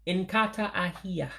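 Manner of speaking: teacher modelling inkata ahia; Igbo phrase. Shown as a demonstration. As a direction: pronounce inkata ahia with stress on inkata. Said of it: "It would be".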